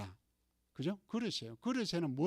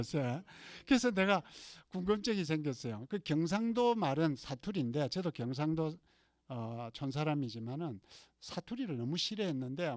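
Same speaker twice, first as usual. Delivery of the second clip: normal talking voice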